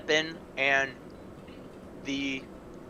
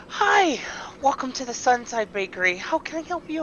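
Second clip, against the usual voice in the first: very high, bright shopkeeper greeting; tiny gnome baker greeting a customer; chirpy gnome; 10 semitones higher, 5 dB louder than usual. Acted. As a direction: squeak out a cheery welcome.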